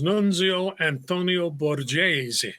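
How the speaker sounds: "Nunzio Antonio Borghese" slow, with full Italian vowels; "Nunzio Antonio Borghese" drawn out the Sicilian way; Italian pronunciation. Shown as a demonstration. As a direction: roll out "Nunzio Antonio Borghese" grandly, every syllable sounded. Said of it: "they didn't talk like that".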